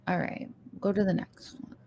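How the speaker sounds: whispered aside; quick whisper to the cohost; whispering to move on. Real, not acted.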